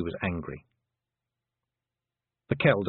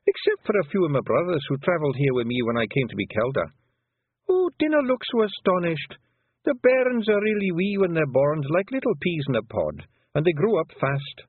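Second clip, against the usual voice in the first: put-on an old clan mother talking fondly of her lads; old Pictsie mother; 8 semitones higher, 3 dB louder than usual. Old Kelder tells Tiffany about her many sons, higher Scots burr.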